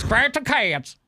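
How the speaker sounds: put-on high-pitched Southern sorority girl drawl; mock Southern drawl. Host parroting a sorority video, high mock Southern drawl. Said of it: "with the Southern drawl".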